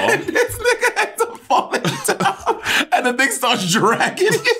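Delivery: high, slow, laughing through the words; words stretched by high laughter; cracking up. Real, not acted. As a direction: tell it while helplessly laughing. Cracking up telling of the fall.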